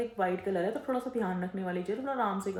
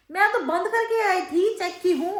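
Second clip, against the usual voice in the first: mimicking the maid; 9 semitones higher, 7 dB louder, slower than usual. Maid's excuse mimicked.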